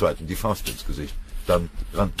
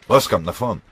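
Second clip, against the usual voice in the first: brisk, louder call to a comrade; called order; 4 semitones higher, 9 dB louder than usual. Acted. Lieutenant calling his man to the front, commanding shout. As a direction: call him forward sharply. Officer calls a man forward.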